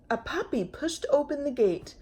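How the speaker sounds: plain reading voice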